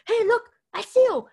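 loud high eager call; loud high eager call of the uncle; excited uncle. Retold or imitated. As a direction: call out as if spotting prey. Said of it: "my uncle was just like".

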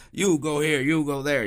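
loud, high barking foreman calling the crew; bossy plant manager. Retold or imitated. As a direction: holler orders across the floor like a boss. Manager assigning workers, cigar in mouth.

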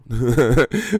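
bursting out laughing; high laugh at own joke; loud laugh. Real, not acted. Laughing at his own impression.

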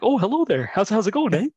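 put-on high, loud comic Canadian accent; hammed-up Canadian accent. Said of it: "speak with a Canadian accent".

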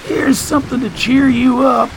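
high, teasing character voice; high-pitched cheering-up patter; Staples character voice. Acted. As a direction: offer it up in a high, pleased-with-yourself voice.

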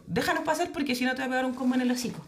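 amenaza infantil, aguda y rápida; niña chiquitita amenazante. Retold or imitated. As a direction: imita a una niña valentona, con risa en la voz. (amenaza de niña, atropellada y juguetona)

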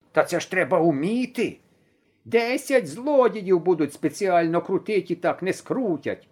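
lightly voiced old man grumbling, slowish; scolding grandfather. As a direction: grandpa cursing the boy as he fixes the wheel.

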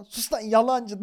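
retold heckle at full volume; imitated yelling. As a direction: shout down a liar, half laughing. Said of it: "diye bağırıyor".

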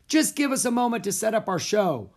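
high, projected stage call; shouted. A narrator's reading. Houdini shouted to the audience, projecting. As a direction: call out to a full theater. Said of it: "Houdini shouted to the audience".

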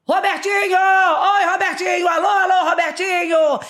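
high, loud calling across the pool; megaphone shout. Retold or imitated. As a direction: hail a friend through a megaphone. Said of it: "aí ela fez assim". (chamando o Robertinho no megafone)

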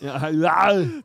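loud, drawn-out one-word impression; Arnold. Shown as a demonstration. Repeating his only party-piece impression for a laughing co-host, loud and stretched. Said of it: "don't you do an Arnold".

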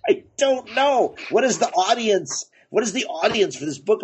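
utterly stumped, voice climbing; exasperated bafflement. Retold or imitated. Acting out bafflement at the book.

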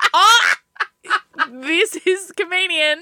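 hammed-up fake delight; sarcastic. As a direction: drip with sarcasm. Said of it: "you would have been like".

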